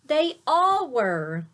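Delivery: very slow and loud; dramatic reveal. A narrator's reading. Narrator unveiling the book characters, slow loud wonder.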